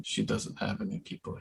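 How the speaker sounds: hushed, voiceless whisper. Acted. Player as Mountain whispering to a companion, whisper. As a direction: whisper it as a private aside. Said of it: "I whisper to Jerome".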